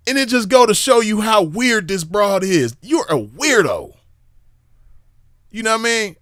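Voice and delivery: mocking scorn. Real, not acted. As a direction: call her a weirdo with a sneer. Mocking the woman as weird.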